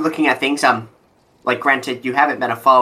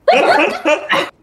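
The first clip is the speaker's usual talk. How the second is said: high greedy cackle; 4 dB louder than usual. Acted. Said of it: "I'm trying to mimic his voice right now".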